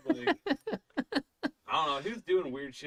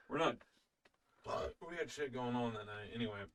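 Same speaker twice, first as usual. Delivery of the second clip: ordinary delivery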